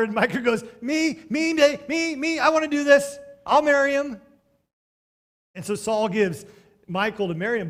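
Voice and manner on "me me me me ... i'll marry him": put-on high, loud eager girl pleading; a hammed-up eager teen begging to be picked. Preacher voicing teenage Michal begging her father, high and eager.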